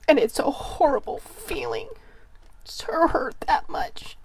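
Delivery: fighting back tears as the voice gives out; choked, near-whispered grief; tearful. Real, not acted. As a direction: voice cracking into a whisper on the last words. Speaker breaking down emotionally.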